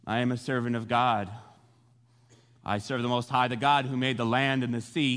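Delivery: low, measured and grave; solemn declaration. Retold or imitated. Jonah answers the panicked sailors who he serves, low and solemn.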